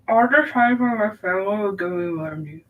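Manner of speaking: words round a paintbrush held in the teeth; paintbrush in mouth. Real, not acted. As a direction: talk with a brush between your teeth. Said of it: "have a paintbrush in my mouth".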